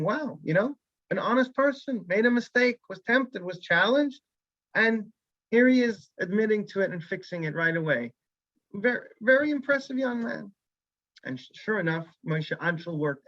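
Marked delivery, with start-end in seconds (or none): 0.0-10.5 high, wondering voice full of admiration; amazed admiration. Acted. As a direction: marvel at the young man's honesty. Rabbi's admiring thoughts on way home.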